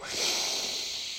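long, soft breath out; overwhelmed sigh. Real, not acted. Speaker catching breath after an ecstatic outpouring, overwhelmed sigh. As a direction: sigh it out softly to recover.

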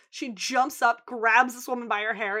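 ordinary delivery

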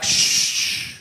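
soft unvoiced shh; hushing. God hushing an impatient David, soft shh. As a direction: hush him like a restless child.

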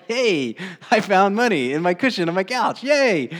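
high, loud giddy delight over found cash; mock thrilled squeal; excited. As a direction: gush like a kid who found money. Mock excited finding money.

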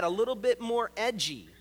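usual speaking voice

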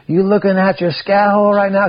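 high, loud mock call; retold teasing holler. Mister Pat to hiker inside the tent, high retold holler. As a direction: holler it teasingly, imitating a friend.

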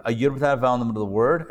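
rattled off quickly like a prompter; fast, flat reading off a script; fast teleprompter read. Shown as a demonstration. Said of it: "I feel like I'm reading, uh, teleprompters".

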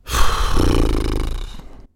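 long low weary sigh; heavy sigh. Real, not acted. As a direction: let out a big tired sigh. Sigh after pirate bit.

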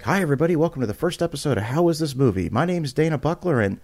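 amped-up, high-pitched show intro; radio DJ. Retold or imitated. Host opening his first movie podcast episode, amped-up radio DJ. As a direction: greet the listeners like an excited radio host. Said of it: "turn into this radio D J".

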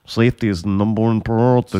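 put-on deadpan Russian-accented catchphrase; imitating a Russian accent; mock Russian accent. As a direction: recite the slogan in a Russian accent. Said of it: "that Russian accent".

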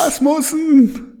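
high crowd shout of the surname. Pretend. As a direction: yell the surname like a fan. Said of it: "im Stadionsprechermanier".

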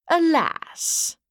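lightly theatrical elven regret; elegant elf. A narrator's reading. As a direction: lament it with lordly grace. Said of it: "an elegant male voice".

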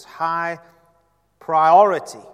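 "high priority" çok yüksek sesle vurgulanmış; stress on high priority. Real, not acted. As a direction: hit "high priority" hard and loud. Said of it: "Give it a place of high value".

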